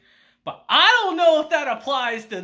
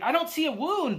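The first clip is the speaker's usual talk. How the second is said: high, puzzled dimwit voice; 9 semitones higher than usual. Retold or imitated. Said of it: "Uncle's like".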